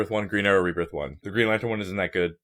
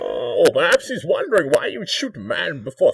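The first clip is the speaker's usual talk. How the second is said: movie villain voice; 8 semitones higher, 9 dB louder than usual. Retold or imitated.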